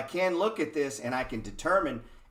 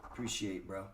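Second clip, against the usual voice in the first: fake polite; 5 semitones lower, 8 dB quieter than usual. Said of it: "You have to be like".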